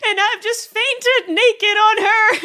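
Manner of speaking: cracking up at the memory; laughing hard. Real, not acted. Telling viewers she fainted naked on mom, laughing loudly.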